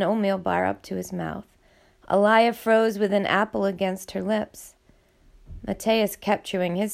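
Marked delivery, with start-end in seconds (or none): none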